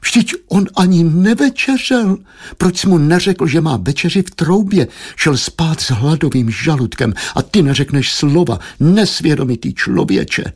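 high, fretful mother's wail; aghast, high-pitched motherly moaning; wailing lament. A narrator's reading. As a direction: draw out the name, then lament and reproach. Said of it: "rozevřela úžasem oči a zabědovala".

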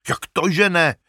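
shepherd crying out that Ilja deserves glory; shepherd's cry. Acted. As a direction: cry out in disbelief. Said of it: "vykřikl pastýř".

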